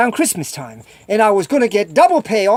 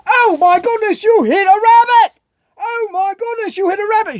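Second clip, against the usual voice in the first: high-pitched imitation of a shocked girlfriend; mock horrified exclamation; 11 semitones higher than usual. Girlfriend exclaims over a rabbit hit while driving, mock horrified. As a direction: cry out in hammed-up alarm, very high.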